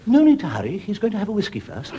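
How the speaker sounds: put-on high, prim old lady voice; old lady calling after the hostess. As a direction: squeak it like a sweet old dear. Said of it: "an old lady said".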